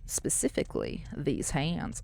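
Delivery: breathy whisper, voice gone, dropping right down; whispered tease. Pretend. Whispering to chat as a spooky joke. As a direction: hush it as a creepy joke. Teasing line, then sorry and laugh.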